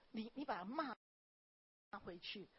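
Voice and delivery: ordinary delivery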